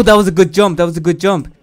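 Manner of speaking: usual speaking voice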